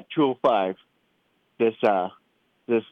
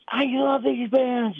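imitated excited kid; 12 semitones higher, much slower than usual. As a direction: mimic a thrilled child, stretching the words up high. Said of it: "you were just excited as heck".

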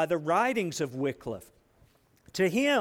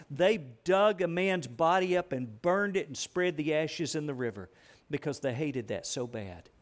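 ordinary talk

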